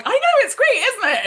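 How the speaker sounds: very high, loud excited squeal; mock gushing enthusiasm. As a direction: gush it, sending up your own excitement. Retelling own gushing reply to Stuart.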